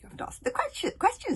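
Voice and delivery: high, sing-song correction; girl teasing the captive; cheeky girl. Acted. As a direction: correct him playfully. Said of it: "said Kamikaze".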